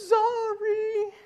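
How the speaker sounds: put-on high, teary little girl's sorry; sobbing child. Father imitating his crying daughter saying sorry. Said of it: "in between sobs".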